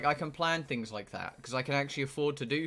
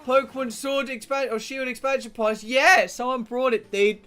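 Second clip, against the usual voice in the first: excited; 10 semitones higher, 8 dB louder than usual. Real, not acted. Thrilled over gifted expansion pass.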